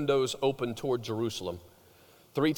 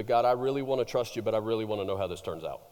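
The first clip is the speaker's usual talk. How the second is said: quick anxious prayer; 6 semitones lower, faster than usual. Retold or imitated. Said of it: "most of us would do the".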